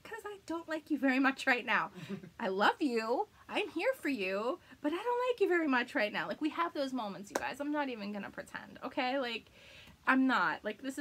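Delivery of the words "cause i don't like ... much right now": teasing retort. Retold or imitated. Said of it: "And I was like".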